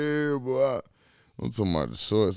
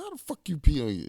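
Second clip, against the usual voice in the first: incredulous; 11 semitones higher, faster than usual. Retold or imitated. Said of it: "I said".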